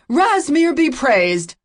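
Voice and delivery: high, loud, slow exultant cry; a fervent priest's loud, slow praise. A narrator's reading. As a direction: proclaim it to the rafters. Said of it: "the priest cried out".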